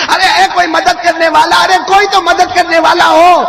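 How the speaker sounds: loud, high call for help; crying for help. Retold or imitated. Stranded companion begging for help, loud and high. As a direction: shout out into the wilderness for anyone. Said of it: "زور زور سے کہنے لگے".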